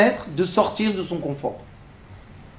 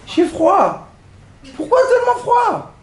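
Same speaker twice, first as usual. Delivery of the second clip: high whine; 12 semitones higher than usual. Retold or imitated. Cold passenger complaining.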